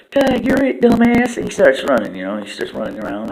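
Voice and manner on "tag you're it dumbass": high, loud cartoon taunt; Fart taunting. Fart slapping Turd and taunting him, high and loud. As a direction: squeal it out as a cheeky tag. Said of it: "he says".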